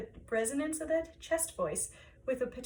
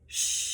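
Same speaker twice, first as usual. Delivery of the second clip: long steady shh pushed from the belly; shush exhale. Shown as a demonstration. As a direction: hiss out the air with a shush. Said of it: "Exhale with a shush".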